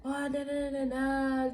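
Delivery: high but soft imitation of distant hollering; mock yelling. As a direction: mimic their jeering as high nonsense syllables. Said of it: "like, yelling".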